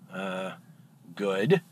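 low, hushed, wary answer; on edge. Acted. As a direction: answer warily, dropping pitch and volume. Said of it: "bartender's really on edge".

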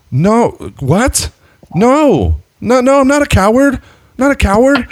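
very high, squeaky mock protest; panicked denial. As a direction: deny it in a flustered high voice.

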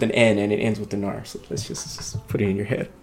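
dropped to a near-whisper; hushed aside. Real, not acted. Host trails off into a hushed aside to the audience. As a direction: let the words slip out under the breath. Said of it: "hushed aside".

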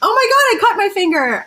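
startled yelp, high-pitched; a sudden pained exclamation; surprised. Real, not acted. Cuts finger on bottle cap.

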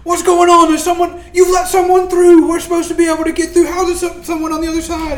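rapid furious crowd shout, words tumbling; angry crowd shouting. Acted. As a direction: scream it like a jostling mob at the gate. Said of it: "are also now shouting".

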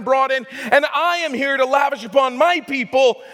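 high, loud preacher's cry over music; preaching at full force. Acted. Preacher proclaiming to the church, at full force. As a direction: proclaim it to the congregation.

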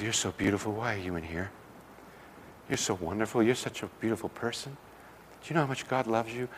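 soft, tender reassurance, well below his speaking level. Retold or imitated. A woman softly assuring a prostitute she is loved, tender. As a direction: gently tell her she is beautiful.

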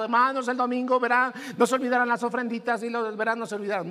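mock nagging reminder, loud and fast; imitating a preacher pushing for offerings. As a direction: rattle off a pushy offering reminder. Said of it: "estarle empujando a cada rato".

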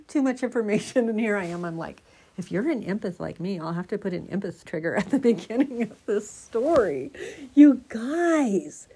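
usual speaking voice

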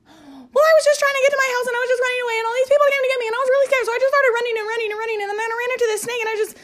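very high, very fast nervous squeak; squeaky fast lizard voice. Pretend. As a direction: gabble it out like a panicked little lizard. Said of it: "a squeaky, fast voice".

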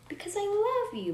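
a touch of curt mother; curtly. A narrator's reading. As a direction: snap back hurt. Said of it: "replied curtly".

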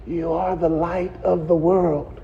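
low, hushed prompting from the pew; mother's hushed prompt. Retold or imitated. Mama prompting her son from the front row, hushed. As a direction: lean in and feed him the line softly. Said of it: "She was trying to whisper to him".

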